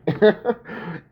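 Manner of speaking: a burst of high laughter. Real, not acted. Retelling the movie, laughing high and bright.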